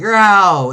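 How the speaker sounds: loud, high mock fighting cry; comic-book attack noise; beating-up yell. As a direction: yelp it out as you land a blow. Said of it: "He starts beating them up like".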